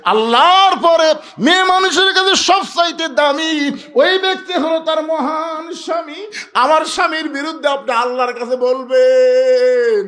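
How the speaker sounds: high, ringing preacher's outcry; impassioned acted protest. Fatema defending her husband to her father, impassioned outcry. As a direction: protest in a high chant, drawing out the end.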